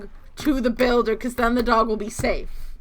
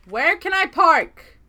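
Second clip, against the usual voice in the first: louder, higher, slow plea to chat; calling out; 6 semitones higher, 5 dB louder, slower than usual. Real, not acted. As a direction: call it out to the audience. Calls same question out loud.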